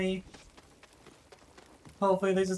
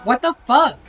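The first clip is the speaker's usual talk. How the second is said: loud; 4 semitones higher, 9 dB louder than usual. Real, not acted. Shouted after repeat.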